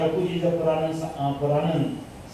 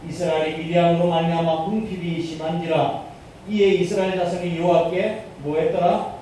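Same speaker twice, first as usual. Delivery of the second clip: usual speaking voice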